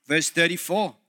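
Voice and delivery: normal talking voice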